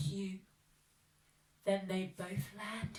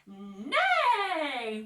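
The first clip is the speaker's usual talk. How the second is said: goose neighing, much louder; 4 semitones higher, 11 dB louder than usual. Acted. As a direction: bellow the neigh with all her might. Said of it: "with all her might".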